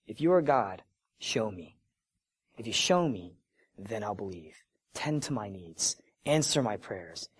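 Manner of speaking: low, soft plea in short separate phrases; bargaining prayer. Acted. Doubter bargains privately with God, hushed low plea. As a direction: drop down and bargain quietly, pausing between demands.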